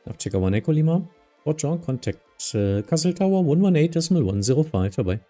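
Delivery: ordinary delivery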